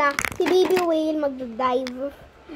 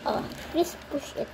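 soft whispered plea; 8 dB quieter than usual. Real, not acted. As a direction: breathe it quietly, almost voiceless. Whispered plea between two plays.

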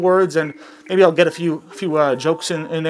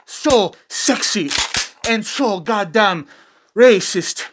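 bad Mexican accent; 3 semitones higher than usual. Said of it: "I'm really bad at a Mexican accent".

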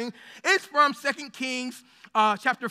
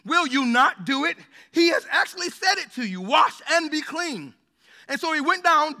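measured, raised voice reading the Bible passage; scripture reading; 4 dB louder than usual. Acted. Pastor reading Second Kings to the congregation, solemn and raised. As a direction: read it out like holy text to the church.

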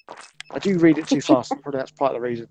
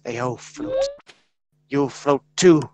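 lightly voiced sinister clown; Pennywise; 3 semitones lower, slower than usual.

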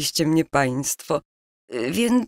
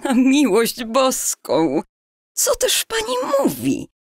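stunned, crying out in disbelief; shocked outburst; 4 semitones higher than usual. Acted. Estera hears impossible family news. (zszokowany okrzyk niedowierzania)

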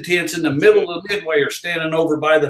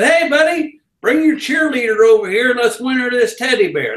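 carnival barker call; 7 semitones higher than usual. Retold or imitated. Carnival worker hollering at a passing couple, barker call. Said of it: "I was that guy that worked in the games that said".

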